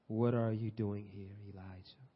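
low, slow, hushed question; God's quiet voice, low and unhurried; gentle divine voice. Acted. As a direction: ask softly, fading on the name. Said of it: "Then a voice said to him".

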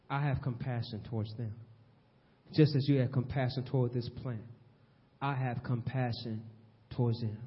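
quiet divine compassion; tender God. Acted. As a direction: say it like a loving father. God's gentle statement of compassion.

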